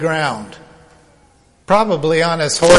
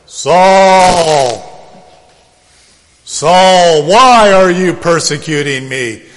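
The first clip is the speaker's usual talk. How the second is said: loud, drawn-out call; booming voice from heaven; 6 dB louder, slower than usual. Acted. Heavenly voice to Saul thrown to the ground, booming. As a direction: thunder it as the Lord confronting a man. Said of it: "It's probably more like".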